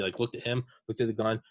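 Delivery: ordinary delivery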